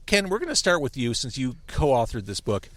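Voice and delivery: ordinary delivery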